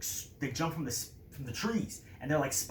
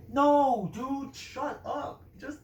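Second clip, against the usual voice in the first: high, loud then dragged-out shut up; guard shutting up his chatty partner; annoyed shout; 11 semitones higher, 5 dB louder, much slower than usual. Acted. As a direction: bark it down, then stretch it slowly.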